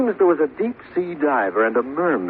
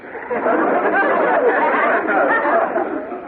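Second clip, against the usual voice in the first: laugh. Acted. Husband laughs over oysters.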